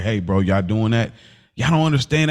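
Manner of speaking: normal talking voice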